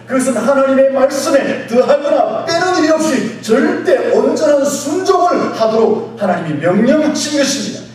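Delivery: loud, high-pitched sermon delivery; full-force preaching. Real, not acted. Preacher declaring obedience to the congregation, full-force preaching. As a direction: drive each point home at shouting force.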